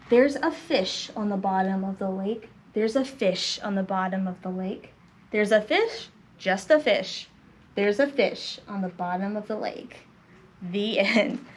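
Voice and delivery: low, hushed storybook close; low, subdued reading to children; story ending read aloud. A narrator's reading.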